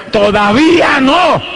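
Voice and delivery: voz de trueno. Retold or imitated. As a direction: roar it like God's thunder. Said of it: "pero con voz de trueno". (voz de trueno atronadora)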